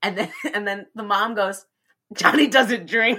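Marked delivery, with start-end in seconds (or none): 2.1-3.2 louder, slower mom line cracking into a laugh; laughing mom quote. Retold or imitated. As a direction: do the mom's line but lose it to laughter.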